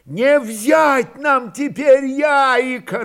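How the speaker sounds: high, slow, loud yell; straining shout. A narrator's reading. Fyodor tries to outshout the crowd, straining. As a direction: strain to be heard over the uproar. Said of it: "надрывался Фёдор".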